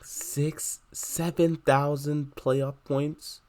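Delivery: high, slow incredulous question; can't believe the number; outraged disbelief. Real, not acted. Reacting to huge playoff points chart.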